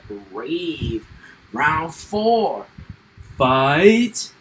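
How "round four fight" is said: booming fighting game announcer. Pretend.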